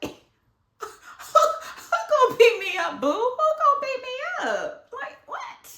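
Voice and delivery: high loud laughing dare; giggling sassy challenge; laughing bravado. Retold or imitated. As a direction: laugh off the threat.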